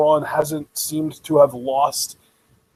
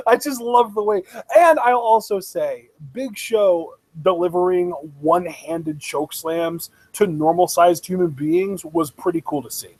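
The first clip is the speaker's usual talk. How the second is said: ordinary delivery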